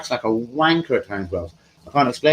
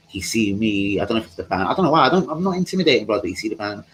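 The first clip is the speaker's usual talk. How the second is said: normal talking voice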